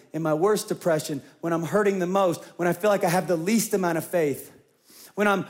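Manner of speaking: ordinary delivery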